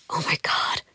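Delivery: breathless whispered gasp of panic; shocked whisper. Retold or imitated. On the train, panicking before the prison visit, whispering.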